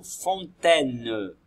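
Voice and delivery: "fontaine" drawn out slowly, syllable by syllable; slow teacher spelling-out. Shown as a demonstration. Teacher showing pupils the syllables, slow and deliberate. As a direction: stretch "fontaine" so the class hears each syllable. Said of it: "à remettre dans l'ordre".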